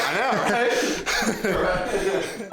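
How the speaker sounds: breathless laugh through the words. Real, not acted. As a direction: laugh it off, panting. Laughing at being winded.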